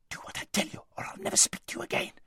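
angry hissed whisper. A narrator's reading. Aravis threatening her friend to make her obey, hissing. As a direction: hiss it as a threat. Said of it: "hissed Aravis".

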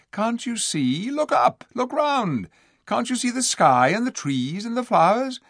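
put-on very high, girlish entreaty; urgent pleading. A narrator's reading. Lucy urging the dwarfs to look, pleading. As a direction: beg them to open their eyes.

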